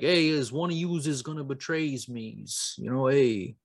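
put-on Jesus in a New York accent, like a Godfather gangster. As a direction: tough New York guy accusing his pals. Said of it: "He had a New York accent".

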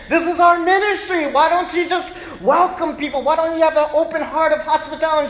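high, loud scolding; mock heated preaching; indignant dad. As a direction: lecture the wife on hospitality. Dad arguing with mom.

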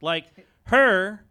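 high, loud punchline push; excited pun. Acted. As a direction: sell the pun, laughing. Narrator explains own pun.

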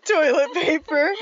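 high-pitched, cracking up as you talk; laughing. Real, not acted. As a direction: say it through a burst of real laughter. Cracks up at co-host's suggestion.

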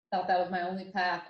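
ordinary talk, nothing marked

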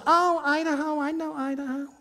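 high-pitched imitation of a New York lady; New York accent. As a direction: put on a high Bronx-style New York voice. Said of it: "That's my New York".

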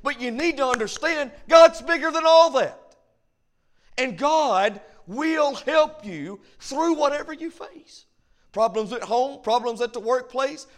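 full-throated sermon delivery; preaching. Acted. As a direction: preach it high and strong to the room. Preacher exhorting the congregation.

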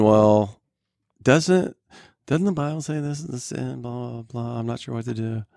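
put-on light Southern twang on a worried teen; country accent. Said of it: "Why'd you do the country accent?".

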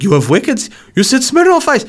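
a puzzled Putin squeaking up; baffled Putin. Retold or imitated. Putin questions Gazza about his drinks, mock disbelief. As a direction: pitch leaps high in mock disbelief.

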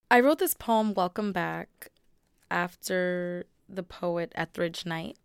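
normal talking voice

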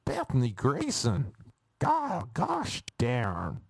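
badly faked American scolding; bad American accent. Mother cursing mildly at her child, bad American accent. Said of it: "These are the accents I can only give you".

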